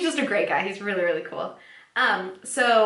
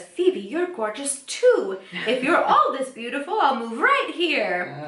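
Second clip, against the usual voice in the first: slow, higher mock Italian charmer, laughing; Italian accent; 5 semitones higher, 3 dB louder than usual. Host voicing Paolo flirting with Phoebe, mock Italian accent. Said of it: "starts talking to her in Italian".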